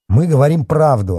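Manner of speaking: high, loud wounded protest; offended. A narrator's reading. Boy is called a liar, offended. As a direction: insist with hurt pride. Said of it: "оскорблённо заявил Артём".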